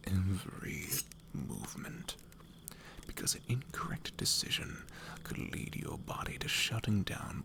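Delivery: hushed, slow, deadly serious whisper; a quiet threat breathed close to his ear; a hardened rogue whispers to the boy about real hunger; menacing whispering rogue. Acted.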